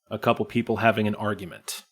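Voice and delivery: normal talking voice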